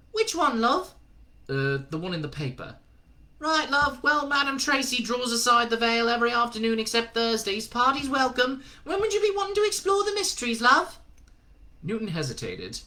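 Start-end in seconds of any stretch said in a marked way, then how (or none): 0.1-11.0 high put-on woman's voice, brisk and friendly; chirpy landlady calling everyone love; Madam Tracy. Madam Tracy answering the phone to Newton, chirpy and high. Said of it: "A woman answered".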